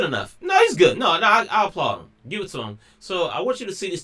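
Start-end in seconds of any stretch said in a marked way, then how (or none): 0.4-2.1 sudden high, loud insistence; excited outburst. Real, not acted. Praising the TikTok guy to viewers, excited loud insistence. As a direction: burst out that he's genuinely good.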